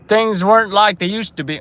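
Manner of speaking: put-on an accent that misses Brooklyn; wrong accent. Streamer starting a story of old times, botched accent. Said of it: "Wrong accent".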